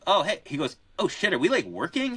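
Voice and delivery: high, startled mock exclamation; a lightly voiced surprised friend; mock surprise. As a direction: play Max caught off guard by the lesson. Max walks up mid-lesson, startled.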